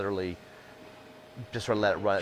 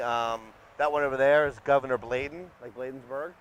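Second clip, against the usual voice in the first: higher, projected tour guide delivery; 6 semitones higher than usual. Acted. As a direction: point out the sights to the group. Guiding a tour group on site.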